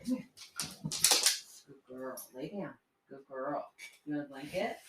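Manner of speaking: barely above a breath to a sleepy dog; hushed to the dog. Real, not acted. Soothing the dog very softly.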